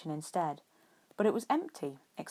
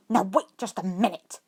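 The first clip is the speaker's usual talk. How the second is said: louder, sharp angry snap; snapped; 3 semitones higher, 8 dB louder than usual. A narrator's reading. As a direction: cut him off hard. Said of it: "one of the Aurors snapped".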